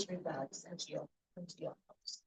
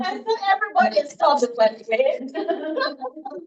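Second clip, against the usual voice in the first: laughing; 7 semitones higher, 7 dB louder, slower than usual. Real, not acted. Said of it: "I'm just trying to confuse you".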